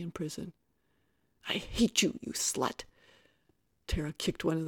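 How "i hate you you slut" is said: hateful outburst. A narrator's reading. Tara cursing Kate as officers hold her, hateful. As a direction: spit out hatred at a rival.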